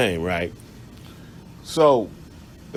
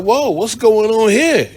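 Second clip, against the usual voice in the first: higher, louder, slow surprise; startled whoa; 8 semitones higher, 6 dB louder, slower than usual. Retold or imitated. Narrator recalling seeing the fistfight, startled and loud. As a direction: react like you just walked into a fight. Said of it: "And I'm like".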